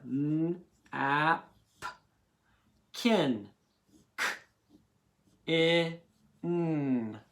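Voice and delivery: napkin pulled apart: n, ap, kin, k, i, n; each sound separately. Shown as a demonstration. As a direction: sound out napkin bit by bit with long pauses. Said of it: "I'm doing each part".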